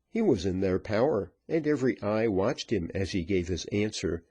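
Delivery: usual speaking voice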